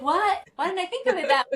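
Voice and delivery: high, quick mock astonishment; imitated surprised realization. Friends hearing her new idea, mock surprised. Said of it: "everyone's, like, kind of like".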